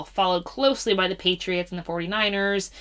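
ordinary talk, nothing marked

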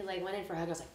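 ordinary delivery

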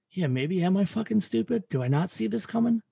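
lightly mocking, anxious self-questioning; self-doubting inner voice. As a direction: play the voice that doubts itself. Mocking her own self-doubt.

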